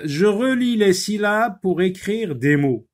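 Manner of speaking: high, loud, projected teacher read; reading out an instruction. Shown as a demonstration. Teacher gives pupils the syllable task, high and loud. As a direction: announce the task emphatically to the class. Reads the exercise instruction aloud.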